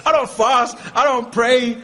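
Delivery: proud declaration. Retold or imitated. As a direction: say it with smug pride. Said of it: "he's so proud of it".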